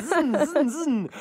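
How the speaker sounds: high laughing zin zin; race car zoom noise. Pretend.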